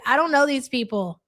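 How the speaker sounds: normal talking voice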